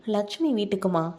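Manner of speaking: ordinary delivery, nothing marked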